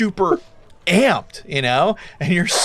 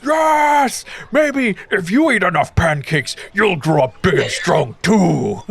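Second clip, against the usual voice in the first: slow, booming hog-man voice; opens on a high cry, then slows down; 4 semitones higher than usual. Acted. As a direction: play the big hog man taking someone under his arm. Game master voices the hog man.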